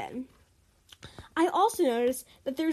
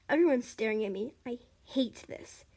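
plain reading voice

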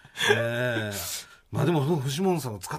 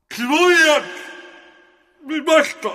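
drawn-out, higher, louder title shout; radio host calling the segment title, drawn out and loud; corner title call; 10 semitones higher, 5 dB louder, much slower than usual. Acted. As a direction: announce the corner name with a big slow drawl.

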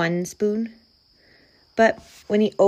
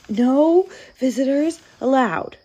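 sniffle the rule out plaintively; weepy bear clinging to a rule; sniffling; 6 semitones higher, 4 dB louder than usual. A narrator's reading. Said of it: "he sniffled".

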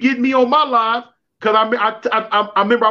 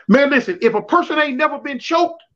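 ordinary delivery

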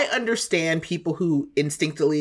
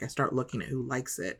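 hushed, lower confiding voice; top secret voice; 4 semitones lower, 11 dB quieter than usual. Real, not acted. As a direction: drop it low like sharing a secret. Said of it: "top secret voice".

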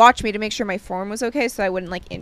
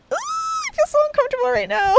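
high squealed mock discomfort; squirming discomfort. Woman retelling her reaction to a wink, squirming high squeal.

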